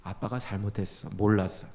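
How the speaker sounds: low, hushed retold apology; soft apology. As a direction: quietly own up to your child. Father apologising to his children.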